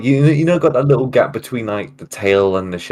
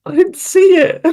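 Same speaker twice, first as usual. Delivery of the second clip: very high, loud little voice; squeaky denial; 5 dB louder than usual. Real, not acted. Telling partner the fix is invisible, squeaky. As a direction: squeak it out way up top.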